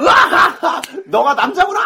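high, hammed-up guffaw running into words; showy forced laugh. Hanger-on flattering the cool boys, showy forced laugh. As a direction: laugh to be noticed, badly overdone. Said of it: "티내면서 웃고".

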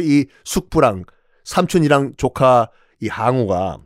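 usual speaking voice

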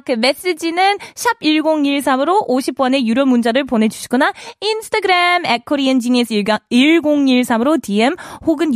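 fast, bright Korean radio read; radio announcer. Acted. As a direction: rattle off the call-in details. Korean radio call-to-action read.

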